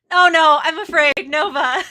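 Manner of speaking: loud, high oh no; loud mock dread. Real, not acted. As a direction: yelp it in mock fear. Reacting to seductive script.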